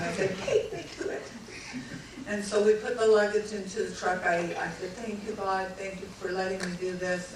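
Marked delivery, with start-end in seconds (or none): 0.4-1.2 eager demand. Retold or imitated. Wife's relieved demand. 5.0-7.3 quiet thanks. Retold or imitated. Quiet grateful prayer.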